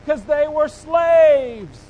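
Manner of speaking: drawn out and loud, each word weighted; slow emphatic declaration. Real, not acted. Preacher to the congregation, slow weighted emphasis. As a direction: let the words land heavy, slowly. Preacher repeats slaves point.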